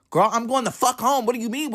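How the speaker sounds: high-pitched, exasperated comeback; indignant retort. Retold or imitated. Answering sister asking where he's going, indignant. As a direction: fire back as if the question is absurd.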